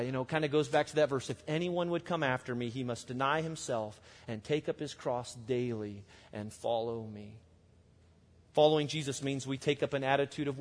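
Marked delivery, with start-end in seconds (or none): 1.3-7.3 reverent hush. Retold or imitated. Quoting Jesus' call, reverent.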